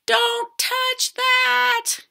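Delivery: high, drawn-out storybook yell; yelled warning. A narrator's reading. Father warning his daughter off the candy bar, yelling. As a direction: stretch each word in a high, urgent cry. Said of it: "Her father yelled".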